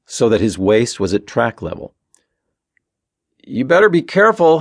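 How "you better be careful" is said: raised, higher-pitched warning call. A narrator's reading. Stephen to Ben over the engines, calling out. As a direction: call a caution over the distance. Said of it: "he called out to Ben".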